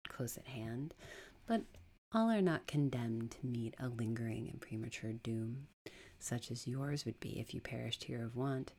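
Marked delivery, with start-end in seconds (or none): 1.5-8.7 lower, hushed and measured; quiet voice. Acted. Unseen stranger to the collapsed woman, quiet. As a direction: speak softly and gravely from nearby. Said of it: "said a voice quiet".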